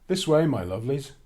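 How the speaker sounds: loud, gallant ogre; sweetened-up ogre voice; charmed ogre. Acted.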